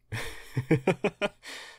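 high, bashful little laugh; shy giggle. Acted. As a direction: laugh off the awkward moment. Awkward laugh before admitting single.

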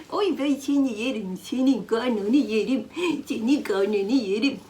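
slow, laughing coo at the cat. Real, not acted. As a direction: gush over the cat's belly, laughing. Doting on the cat. (kediyi severken yavaş, gülerek sevgi sözleri)

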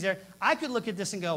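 usual speaking voice

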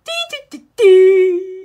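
high beeps that burst loud at the end; loud mock beeps. Mocking the computer with loud high beeps. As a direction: blare the beeps like an alarm.

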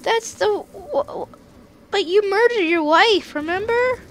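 put-on high girly voice; squeaky little girl voice. Girl voice game banter.

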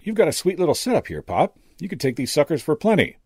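high, sly hustler's pitch; drawling. A narrator's reading. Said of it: "he drawled".

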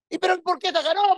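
very high put-on reporter question; mock reporter. Preacher imitating a reporter asking the fan, very high voice. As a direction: ask it like a puzzled interviewer.

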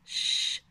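ش held as a voiceless hush, no vowel; drawn-out ش on its own; isolated letter sound. Shown as a demonstration. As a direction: sustain just the sound of ش. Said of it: "أصله إلى حرف الشين".

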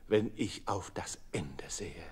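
hushed, unvoiced foreboding; whisper. A narrator's reading. Narrator whispering a dark foreshadowing to listeners. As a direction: breathe it like a dark secret.

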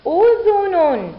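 أذن joined as one high, loud word; teacher's slow whole-word model; blended word reading. Shown as a demonstration. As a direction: read udhunun unbroken, drawn out. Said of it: "لنقرأ".

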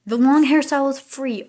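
high, loud thrilled news; excited announcement. Real, not acted. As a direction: burst out with the good news. Announces free hairstyle.

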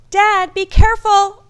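high, loud cry of alarm; child yelling out to a parent; shouted warning. A narrator's reading. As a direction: shout a worried warning across the ice. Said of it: "I shouted".

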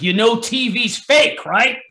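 sarcastic Italian New York father, dragging it out; Italian New York accent. Retold or imitated. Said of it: "Italian New York accent".

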